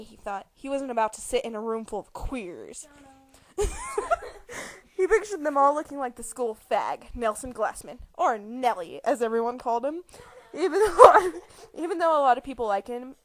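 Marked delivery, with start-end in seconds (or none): 3.6-4.9 high, helpless giggle; burst of laughter. Real, not acted. Reader cracking up at the word queers. 10.5-13.1 words pushed out through giggles, louder; laughing while reading. Real, not acted. Reader giggling through a line about Nelson.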